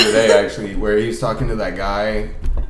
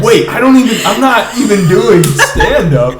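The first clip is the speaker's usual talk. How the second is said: mock panic; 8 semitones higher, 7 dB louder than usual. Retelling his panic on stage.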